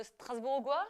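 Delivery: Strasbourgeois with a hard g, as a question; unsure Strasbourgeois, g as in "go"; mispronounced Strasbourgeois. Real, not acted. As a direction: ask "stras-boor-gwa" for Strasbourgeois, rising at the end. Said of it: "I've just been corrected".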